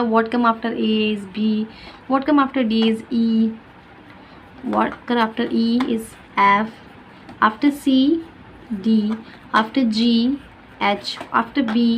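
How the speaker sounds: What come after said very slowly; slow teacher drill. Shown as a demonstration. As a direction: read the sequence out slowly. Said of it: "teacher quizzing child". (बच्चे के लिए बहुत धीरे पढ़ें)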